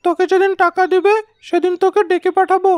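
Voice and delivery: high, loud, scolding henchman; angry henchman. Acted. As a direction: drive the man off with a rebuke. (রফিককে ধমকানো চাকরের উঁচু রাগী গলা)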